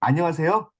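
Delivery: normal talking voice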